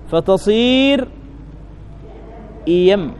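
فتصير stretched very slowly, then إيم said clearly; slow buildup to the form إيم; demonstrating the form. Shown as a demonstration. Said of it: "قلبت الهمزة الثانية ياء". (نطق إيم ببطء ووضوح)

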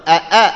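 "أ، أ" as two loud, separate glottal stops; both hamzas articulated. Shown as a demonstration. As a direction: punch each أ cleanly. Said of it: "أنطقها محققة". ("أ، أ" همزتان محققتان)

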